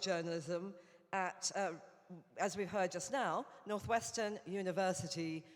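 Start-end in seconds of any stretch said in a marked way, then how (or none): none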